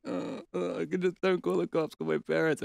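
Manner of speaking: high-pitched imitation of panicked begging; mock pleading. Caught teen begging the shopkeeper to call his parents, mock pleading.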